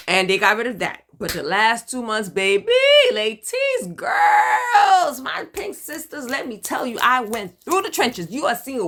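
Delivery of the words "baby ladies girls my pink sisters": high, loud, drawn-out call to the ladies. Real, not acted. Calling out to her pink sisters, high and loud.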